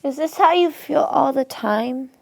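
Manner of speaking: high, teary whimper; tearful. Acted. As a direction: ask it through tears. Co-anchor overwhelmed with grief.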